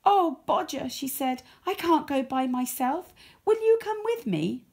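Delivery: begging a friend not to go alone; nervous pleading. Acted. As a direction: Rosie nervously asking Bodger to come. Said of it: "She was rather nervous".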